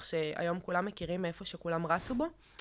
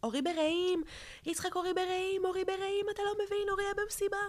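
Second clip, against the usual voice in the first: high, frantic cry of panic at the brother; screaming into the phone in panic, pitched high; retold frantic screaming; 13 semitones higher than usual. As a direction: shriek it up high in desperate panic. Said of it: "הוא יגיד שאני צורחת בטלפון".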